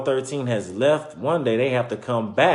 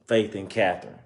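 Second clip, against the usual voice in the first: usual speaking voice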